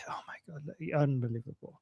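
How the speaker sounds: whispered, quick and hushed; stunned whisper. Real, not acted. Co-host reacts to Goldie's story in a stunned whisper. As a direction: breathe it out in quiet disbelief.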